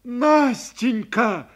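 loud, slow drawn-out cry; shout of her name. A narrator's reading. Young man crying out to the weeping girl, loud. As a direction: burst out calling her name. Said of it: "закричал я".

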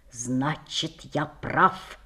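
low, soft, quietly triumphant; triumphant whisper. A narrator's reading. Boy detective proved right to his friend, quietly triumphant. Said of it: "торжествующе прошептал".

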